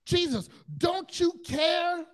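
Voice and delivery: high, loud, slow anguished cry; loud, pleading cry of despair; desperate plea. Retold or imitated. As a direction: shout it out as the boat sinks. Disciples cry out in storm.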